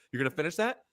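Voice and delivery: taunting. Retold or imitated. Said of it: "I was like".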